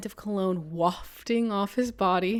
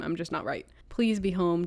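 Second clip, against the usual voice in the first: ordinary delivery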